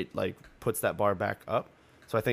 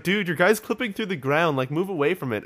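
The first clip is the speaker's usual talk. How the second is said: calling out; 8 semitones higher, 5 dB louder than usual. Retold or imitated. Calling out to a co-op partner.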